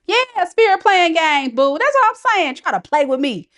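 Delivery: high-pitched crowing at spirit; playful indignant outburst. Real, not acted. Reader teasing spirit for playing games, playfully indignant. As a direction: call it out, high and animated.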